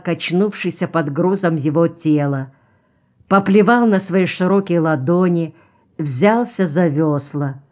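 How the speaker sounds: usual speaking voice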